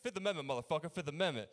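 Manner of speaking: shouted defiance at the cop; loud mock defiant shout; defiant yell. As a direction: yell it back like a cornered drunk. Drunk suspect yelling again.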